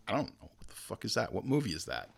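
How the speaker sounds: low, quiet baffled reply; mock puzzled, low and offhand; clueless dismissal. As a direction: imitate someone with no idea, softly. Acts out clueless people asked about film.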